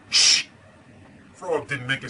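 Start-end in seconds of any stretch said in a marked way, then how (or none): 0.1-0.4 a big theatrical shh; storybook shush, loud and drawn out; loud hushing shh. Acted. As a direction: hush the audience loudly. Frog book refrain, hushing.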